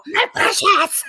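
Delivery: high, shouted creature hiss of my precious; Gollum voice. Retold or imitated. Guest imitating the greedy ring creature, high shout. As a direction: clutch the ring and shriek it out possessively. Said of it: "just like Bilbo".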